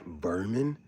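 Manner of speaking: normal talking voice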